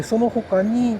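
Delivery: ordinary delivery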